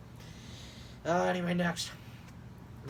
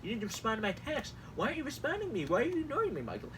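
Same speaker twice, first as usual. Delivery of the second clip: higher, whiny pestering voice; jealous ex-boyfriend; 5 semitones higher than usual. Acted. Host mocks campaign texts as a jealous ex-boyfriend. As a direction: plead like a needy ex. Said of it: "jealous ex-boyfriends who want senpai to notice".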